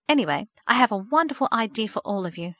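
ordinary delivery, nothing marked

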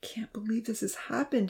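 breathless, stunned whisper; barely able to say it aloud; shocked whisper. A narrator's reading. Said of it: "breathed heavily".

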